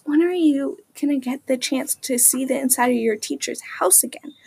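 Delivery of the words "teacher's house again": hushed whisper to a friend; whispered aside. Acted. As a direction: drop to a secret whisper. Girl adds aside to friend.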